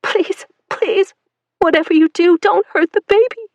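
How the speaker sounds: high, loud, desperate begging; mother begging soldiers to spare her baby, sobbing; sobbing plea. Acted. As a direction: plead through sobs. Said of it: "Celine choked on her sobs".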